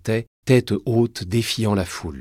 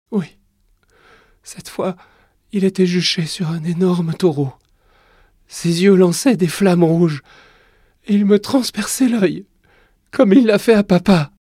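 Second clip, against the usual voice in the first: put-on high child voice growing louder; frightened child; 11 semitones higher, 9 dB louder than usual. Young king telling his mother his nightmare, frightened. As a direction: relive the nightmare, rising in pitch and panic.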